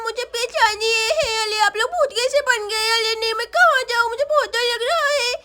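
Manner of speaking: put-on small scared child with babyish consonants; frightened little Sonam. Daughter pleads with ghost parents then hides, scared child voice.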